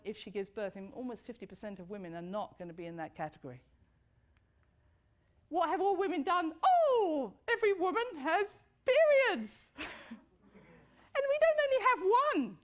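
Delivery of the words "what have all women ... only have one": much higher and louder, bubbling with laughter; gleeful laughing outburst about periods; excited laughing. Real, not acted. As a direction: let the giggles push it up high.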